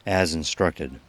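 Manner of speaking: ordinary talk, nothing marked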